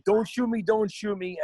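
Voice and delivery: imitating a panicked husband pleading; mock pleading. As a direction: beg not to be shot, hammed up. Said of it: "he's like".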